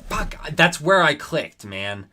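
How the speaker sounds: loud, high-pitched exasperated protest; frustrated outburst. Real, not acted. Gamer insisting they clicked the right spot, real exasperated outburst. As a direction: burst out in real frustration at the game.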